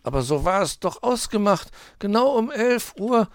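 high, small-character protest; sheepish. A narrator's reading. Weißohr defends turning up at eleven, abashed. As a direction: plead the agreement, abashed. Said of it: "sagte Weißohr betreten".

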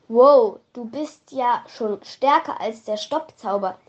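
ordinary delivery, nothing marked

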